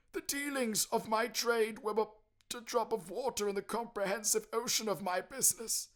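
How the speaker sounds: high, loud mournful spectre; anguished ghost lament, slowing at the end. Acted. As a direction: pour out regret like a damned soul. Ghost voice in story.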